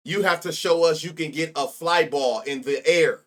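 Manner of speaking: deliberate, loud articulation on "fly ball in the air"; careful enunciation. Real, not acted. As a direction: spell out "fly ball in the air" word by word, emphatically. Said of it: "say it slow to make sure I say it right".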